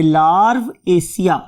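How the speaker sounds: larvaceae drawn out slowly in two parts, larv and aceae; split into parts. Shown as a demonstration. Teacher divides the name for students, slow two-part larvaceae. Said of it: "इस नाम को divide कर दिया दो parts पे".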